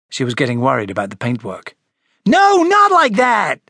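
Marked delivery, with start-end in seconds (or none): 2.3-3.6 high, drawn-out yell; angry yell. Acted. Frederick shoving Charlie away from the skateboard, impatient yell. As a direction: yell it loud, stretching each word. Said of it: "yelled Frederick".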